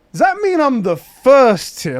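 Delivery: high, loud, drawn-out disbelief; astonished question. Retold or imitated. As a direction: act out dawning amazement, slowly. Acting out astonishment at winning.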